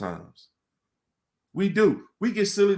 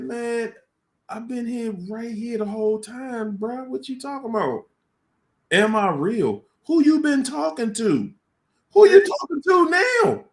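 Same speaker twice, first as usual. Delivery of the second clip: God answering; 9 semitones higher, 3 dB louder than usual. Retold or imitated. Retelling how God answered him, high, growing into a shout. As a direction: start gently amused, then rise into a booming challenge. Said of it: "and God just said".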